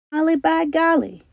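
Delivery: taken aback to see her geared up; surprised exclamation. A narrator's reading. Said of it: "explained the surprised captain".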